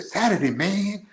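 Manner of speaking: stretched-out, hammed-up American accent; father's American accent. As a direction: imitate dad faking an American accent. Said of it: "my father talk with his American accent".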